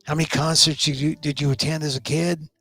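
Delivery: usual speaking voice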